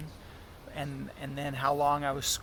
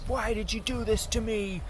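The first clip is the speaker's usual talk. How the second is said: higher mock rant, half-hearted screaming; retold angry screaming; 6 semitones higher than usual. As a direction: replay the raging outburst at the guy, toned down. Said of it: "and I screamed at the guy".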